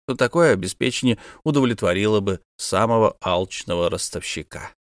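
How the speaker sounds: ordinary delivery, nothing marked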